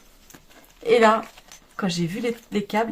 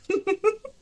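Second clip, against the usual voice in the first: giggle; 9 semitones higher than usual. Real, not acted. Laughing about buying the needles.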